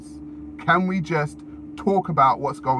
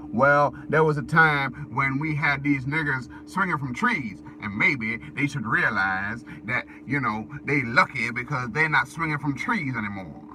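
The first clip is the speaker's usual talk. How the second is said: menacing bigot voice. Retold or imitated. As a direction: voice a smug hateful bigot. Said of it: "And then you got".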